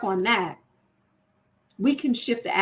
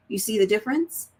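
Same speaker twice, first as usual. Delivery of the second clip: normal talking voice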